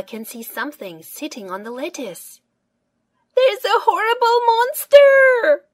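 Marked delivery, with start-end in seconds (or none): 3.4-5.7 high, loud fake scream of horror; much higher, louder childish panic; snorting in mock fright. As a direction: shriek it like a little girl spotting a monster. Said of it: "she snorts".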